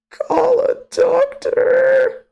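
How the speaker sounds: very high, slow crying-laugh; laughing wail. Real, not acted. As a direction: wail it through tears of laughter.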